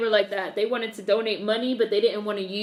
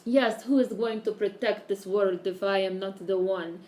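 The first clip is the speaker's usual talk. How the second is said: thick mock Eastern European accent; Gal Gadot impression. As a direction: put on a Count Chocula-like Eastern European accent. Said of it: "Gal Gadot talks like Count Chocula".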